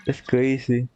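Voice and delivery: normal talking voice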